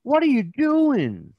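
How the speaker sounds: high, drawn-out mock kid yell; imitated scandalized yell, stretched out. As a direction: yell like a shocked child in a joke. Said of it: "And he yells".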